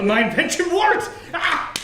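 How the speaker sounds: high, excited cheer; excited triumph. Acted. Inventor sees his saw succeed.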